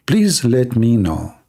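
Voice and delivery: ordinary delivery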